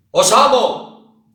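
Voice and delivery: "Os amo" gritado, agudo y lento. Shown as a demonstration. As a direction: grita "Os amo" a pleno pulmón. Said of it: "os digo". ("Os amo" a gritos, despacio)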